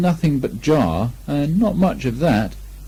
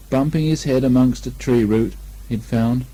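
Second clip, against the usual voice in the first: ordinary delivery, nothing marked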